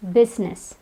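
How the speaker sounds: business in two syllables; silent I. Shown as a demonstration. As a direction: say business as BIZ-ness. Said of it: "the I is silent".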